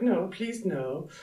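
high mock plea; mock protest. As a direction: squeak out a protest. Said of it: "and she's just like".

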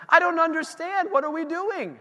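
exasperated disbelief. Retold or imitated. Pastor voicing his own frustration to the congregation, exasperated. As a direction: throw up your hands in mock frustration. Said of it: "And I'm like".

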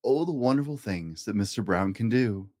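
plain reading voice

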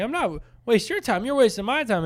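high, protesting mock retort; imitated indignant caller; prank caller. Retold argument with scammer.

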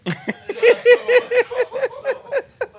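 long high laughing; real amused laughter. Real, not acted. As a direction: laugh out at the joke. Laughing at naming joke.

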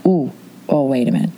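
ordinary delivery

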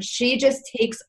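normal talking voice

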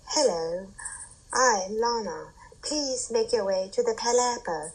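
put-on poised robot hostess with an English accent. Lana calling contestants to the Palapa, English accent. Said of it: "she has an English accent".

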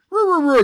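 high, loud mock robot grunting through a laugh; voiceless robot grunts. Host imitating voiceless Bumblebee, laughing robot grunts. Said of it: "Bumblebee's like".

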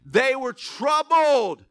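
emphatic reading, key words drawn out slowly; preaching scripture. Acted. Preacher reads disciples' fright to the church, emphatic preaching. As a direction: read it out loud to the room, stretching the key words.

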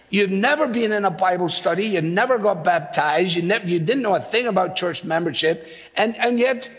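normal talking voice

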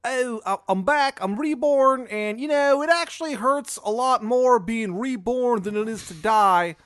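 high, loud wail of complaint; unhappy Shithead Steve. Acted. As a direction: moan loudly in a hammed-up, high Maryland voice. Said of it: "He doesn't look happy".

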